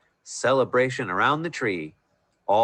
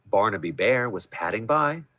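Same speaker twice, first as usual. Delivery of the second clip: ordinary delivery, nothing marked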